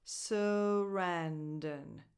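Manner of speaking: very slow, stretched "Sarandon", suh-RAN-dun; "Sarandon" in slow motion, weight on the second syllable; second-syllable stress. Shown as a demonstration. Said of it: "The stress goes on the second syllable".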